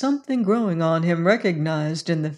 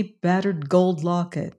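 ordinary delivery

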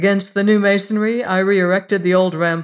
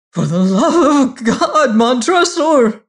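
desperate cry; 8 semitones higher, 8 dB louder than usual. Acted. Fortunato's last plea from the wall: loud, high scream. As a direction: cry out in terror. Walled-in man's final plea.

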